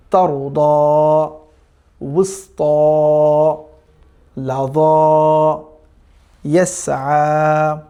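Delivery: each Arabic word spaced out, slow and clear; measured recitation, one word at a time; slow Quran reading demo. Shown as a demonstration. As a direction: read it out slowly for learners to repeat. Reads Arabic words slowly, syllable by syllable.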